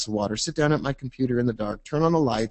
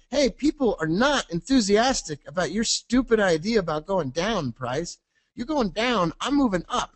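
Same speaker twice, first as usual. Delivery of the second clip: high mocking cartoon voice; momentum scolding; 8 semitones higher than usual. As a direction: talk down to price, playfully.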